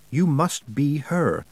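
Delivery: slow, deliberate words with no feel for their meaning; unnatural Wintersmith. A narrator's reading. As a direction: say each word slowly, like sounds learned by rote. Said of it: "as if the Wintersmith had been taught to say the sound of words without understanding".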